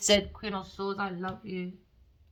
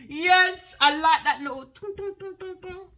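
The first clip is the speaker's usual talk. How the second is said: giggly; 11 semitones higher, 10 dB louder than usual. Real, not acted. Giggling over his chest.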